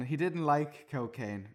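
ordinary talk

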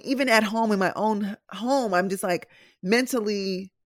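ordinary talk

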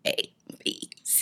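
letters burped out on command; croaky belched letters; burped alphabet. Shown as a demonstration. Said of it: "I can burp the alphabet".

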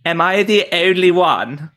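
plummy upper-class British accent; posh British accent; Crown posh accent. Shown as a demonstration. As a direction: say it like royalty in The Crown. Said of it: "the Crown version".

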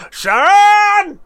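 very high, loud yell of a name; shouted impression. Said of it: "Brummie accent, like Aussie?".

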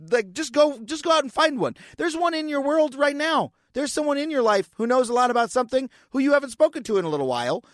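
high-pitched, breathless enthusiasm building to a peak; over-excited. Real, not acted. Host urging listeners to go find an expert, over-excited. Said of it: "hyperventilating because I'm getting so excited".

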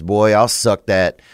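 normal talking voice

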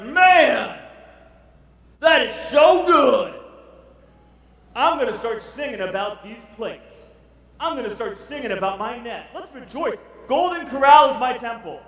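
loud, high, gloating feast talk; gloating Babylonians. Retold or imitated. Babylonians gloating over their buffet plates, loud and high. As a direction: revel loudly over the full plates. Babylonians voiced as gorging diners.

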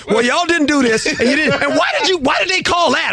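high mock wife interrogating; imitating wife. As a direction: fire questions as his wife. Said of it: "she had everything written down".

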